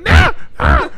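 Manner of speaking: short hammed-up cries of protest; mock yell. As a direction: imitate someone yelping as they get tackled. Acting out Tommy being taken down.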